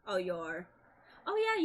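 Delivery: normal talking voice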